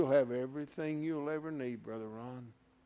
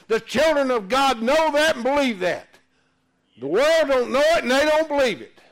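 loud, high revival preaching; full-force preaching; 9 semitones higher, 10 dB louder than usual. Acted. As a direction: shout it out to the congregation.

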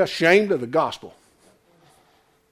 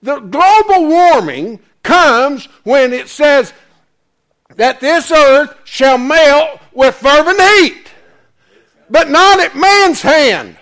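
slow, loud, higher sermon delivery; full-force preaching; 7 semitones higher, 8 dB louder, slower than usual. Real, not acted. Preacher warning the congregation about the earth melting, slow and thundering. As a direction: thunder out the prophecy slowly.

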